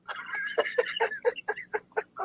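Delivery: silent, wheezing giggle; airy high laugh. Real, not acted. To his viewers, reading their comments, an airy giggle. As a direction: laugh with no voice at all.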